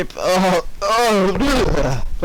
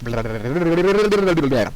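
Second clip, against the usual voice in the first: ordinary delivery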